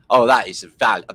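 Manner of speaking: usual speaking voice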